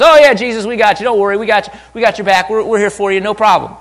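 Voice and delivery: loud, high, overeager disciples; rowdy chorus of agreement. Acted. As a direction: pile in with hammed-up reassurance. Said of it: "joined in in some sort of chorus".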